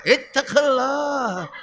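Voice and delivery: slow, heavy warning; drawn-out commanding admonition; stern sermon cry. Retold or imitated. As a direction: drive the word home like a sword. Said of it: "Kalau khutbah itu pedangnya".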